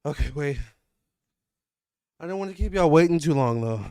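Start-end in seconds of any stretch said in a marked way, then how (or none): none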